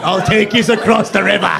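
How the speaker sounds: lantern-man British caricature; bad British accent. Guy with the lantern offers passage, bad British accent. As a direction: ham up a British ferryman's accent. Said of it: "All of our British accents are that guy with the lantern".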